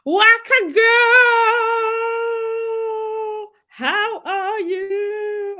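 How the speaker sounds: very high, drawn-out squeal of hello; gushing greeting. Acted.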